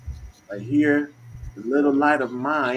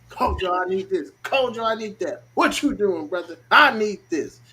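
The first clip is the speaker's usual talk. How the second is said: higher-pitched mock pleading; a hint of needy callers, pitched up; imitating pestering clients; 5 semitones higher than usual. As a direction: lightly mimic people nagging for favours. Mimics people pestering him for readings.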